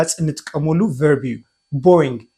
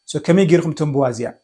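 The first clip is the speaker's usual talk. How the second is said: usual speaking voice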